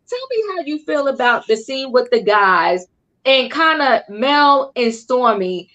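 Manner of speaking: normal talking voice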